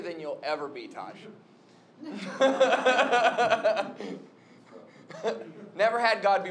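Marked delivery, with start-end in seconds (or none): 2.0-4.2 long rapid burst of laughter; big sustained laugh; loud laugh. Real, not acted. Jokes about God as trainer.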